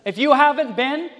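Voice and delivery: normal talking voice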